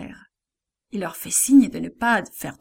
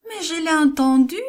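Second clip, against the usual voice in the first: high, louder insistence; insisting; 7 semitones higher, 5 dB louder than usual. A narrator's reading. Servant girl insisting she heard Peter, high loud voice. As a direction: refuse to back down. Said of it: "insiste Rhodes".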